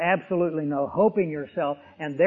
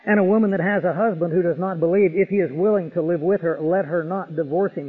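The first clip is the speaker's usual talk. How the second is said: louder, ringing pulpit voice quoting Paul; raised preacher voice reading scripture aloud; full-force preaching; 5 dB louder than usual. Acted. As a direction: proclaim the verse to the whole hall.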